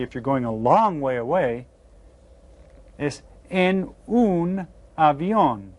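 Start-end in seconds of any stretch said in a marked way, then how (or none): 3.5-5.7 slow, careful Spanish "en un avión"; Spanish model word. Shown as a demonstration. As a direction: say "en un avión" slowly, like a teacher modelling. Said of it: "jot these down in your Spanish notebooks".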